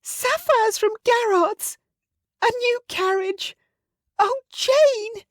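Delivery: very high, slow, swooning squeals; Missus Bennet, overjoyed. Acted. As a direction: gasp out the gifts in a very high rapture.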